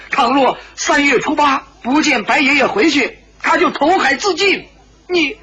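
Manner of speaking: high-pitched angry reproach; furious. A narrator's reading. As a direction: protest heatedly in a high voice. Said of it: "石破天大怒". (愤怒地高声责问)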